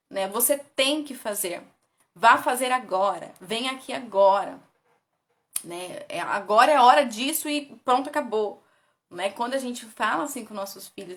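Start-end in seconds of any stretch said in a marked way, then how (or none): 0.3-4.6 firm, slow parental orders; demanding tone. Shown as a demonstration. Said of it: "num tom de exigência". 6.1-8.6 loud bossy parent; insist, now and no arguing; demanding tone. Shown as a demonstration. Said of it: "nesse tom de exigência".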